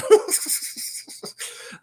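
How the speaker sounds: sudden high-pitched unvoiced laugh. Real, not acted. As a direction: burst into a high airy laugh at yourself. Laughs at himself being amped.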